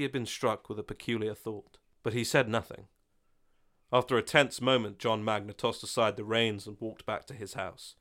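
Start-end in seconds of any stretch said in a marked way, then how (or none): none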